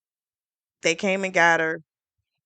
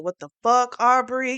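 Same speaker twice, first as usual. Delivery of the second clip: a raised, half-acted "what the fuck"; mock outraged shout; 6 semitones higher, 4 dB louder than usual. Recapping a show, the boss yells at Aubrey, mock outrage. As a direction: play the boss caught out, snapping back.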